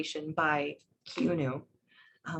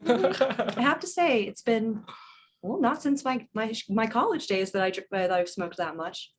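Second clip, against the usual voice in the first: a bit tipsy confession to a new friend; motherly character; 4 semitones higher, 6 dB louder than usual. Acted. Plays her character.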